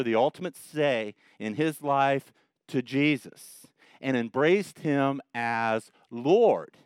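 slow, weighty preacher delivery; slow proclamation. Acted. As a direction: lay each phrase down deliberately. Solemn defining proclamation.

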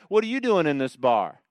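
loud, high, needling challenge; mock-indignant accuser, loud and high; accusing unbeliever. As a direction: call out a churchgoer caught in a bar. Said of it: "The unbeliever always knows how we should act".

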